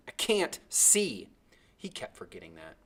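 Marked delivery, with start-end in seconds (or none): 0.1-1.3 slow, high angry complaint. A narrator's reading. The blind boy angrily answers Timothy, slow complaint. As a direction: snap it back, annoyed and slow. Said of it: "Angrily, I said to him".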